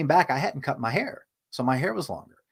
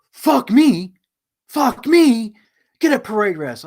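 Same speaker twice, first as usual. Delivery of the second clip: high, loud, outraged sergeant bellow; mock officer yell; 10 semitones higher, 10 dB louder, slower than usual. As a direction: blow up like a furious officer. Officer yelling at him.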